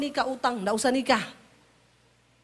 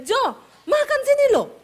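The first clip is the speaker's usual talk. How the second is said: loud, high-pitched call to come eat; high loud call to dinner; high Sulawesi call; 12 semitones higher, 7 dB louder than usual. Retold or imitated. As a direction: yell him over to the table, pitched high. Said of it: "Orang Sulawesi kalau ngomong nadanya tinggi terus".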